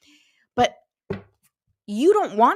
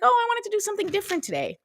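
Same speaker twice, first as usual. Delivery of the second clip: breezy high excuse; 10 semitones higher, much faster than usual. Retold or imitated.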